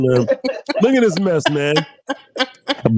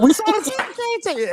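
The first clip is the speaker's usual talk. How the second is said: repeating the last word like an echo fading out; mock echo; 11 semitones higher, much faster than usual. Friend retelling the video, imitating its echo effect. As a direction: make tape bounce back like an echo.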